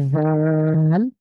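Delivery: জাল with tongue between teeth, thin airflow; tongue out on the teeth, breathe জাল thinly; thin dhaal. Shown as a demonstration. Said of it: "পাতলা করে বাতাস বের করে".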